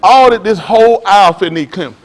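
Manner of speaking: ordinary talk, nothing marked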